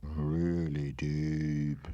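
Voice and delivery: put-on deep disguise; disguised deep voice. Shown as a demonstration. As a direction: drop into a very deep, slow voice by the end. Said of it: "disguise my voice".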